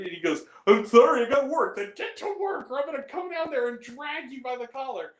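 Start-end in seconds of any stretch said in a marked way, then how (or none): 0.7-1.7 retold friend's excuse. Voicing Ben's excuse. 1.8-5.1 high-pitched mock-furious rant; worked-up, higher comic scolding; retold angry threat. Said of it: "I was so angry".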